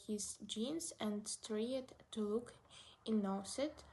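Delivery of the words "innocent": ordinary delivery, nothing marked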